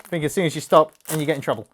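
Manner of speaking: normal talking voice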